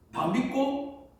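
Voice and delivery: slow and high, drawn out; God's voice. Retold or imitated. God telling the pastor to trust him, slow and high. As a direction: stretch it out like a voice from heaven. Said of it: "이러는데".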